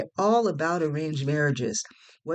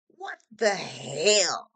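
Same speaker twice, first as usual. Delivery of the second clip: very slow, drawn-out exclamation of disbelief; upset exclamation; 3 semitones higher, much slower than usual. A narrator's reading. Friend upset with both of them, exclaiming in drawn-out disbelief. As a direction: stretch each word out, appalled. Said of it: "she exclaimed".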